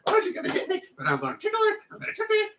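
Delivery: high, laughing playful squeal; giggly high teasing. Pretend.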